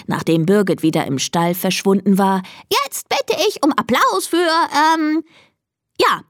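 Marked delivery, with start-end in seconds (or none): 2.7-6.2 high crowing announcer who stalls; crowing rooster. A narrator's reading. Rooster Konrad hesitates over the next contestant, crowing haltingly. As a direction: crow grandly, then falter for the name. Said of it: "krähte Konrad".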